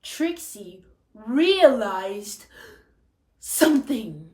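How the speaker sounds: slow, loud dawning discovery; wide-eyed realization. Shown as a demonstration. Teacher reading to class, slow amazed realization. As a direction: let the big moment land slowly. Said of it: "So you need to embody that".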